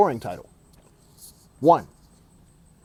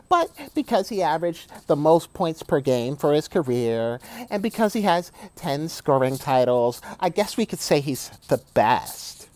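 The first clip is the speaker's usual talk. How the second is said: Braun fanboy mode; 2 semitones higher than usual. Retold or imitated. Mocking LeBron fans to his viewers, swooning fanboy voice. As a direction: gush over LeBron like a starstruck fan. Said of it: "I'm going into Braun fanboy mode".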